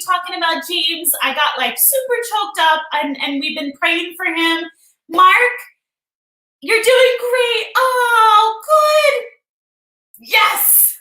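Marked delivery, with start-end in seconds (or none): 5.1-10.9 cheering. Real, not acted. Cheering viewer on.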